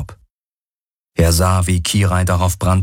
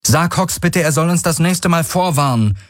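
Kirai calls out, much higher and urgent; calling out; 11 semitones higher than usual. Acted. Kirai calls to Galen about Hox, much higher. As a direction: call it across to Galen, high and pressing. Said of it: "rief Kirai".

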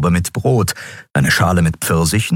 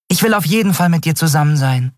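hohe schnelle Kleinfigur; 11 semitones higher, faster than usual. Acted. Nelen spricht hoch und schnell.

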